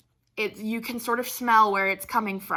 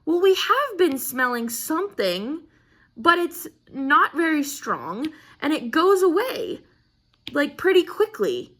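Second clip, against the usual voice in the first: defensive explaining; 6 semitones higher, 3 dB louder than usual. Retold or imitated. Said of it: "And so we go".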